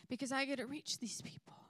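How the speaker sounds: a private, breathy realisation; whispered thought. Retold or imitated. Realising her calling at the fair, whispered. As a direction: whisper it to yourself.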